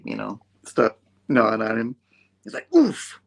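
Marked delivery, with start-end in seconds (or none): 2.7-3.2 loud, high oof; mock grunt of pain; imitated pained oof. Imitates Strange after the imp attack.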